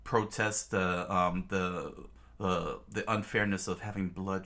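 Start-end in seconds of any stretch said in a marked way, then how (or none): none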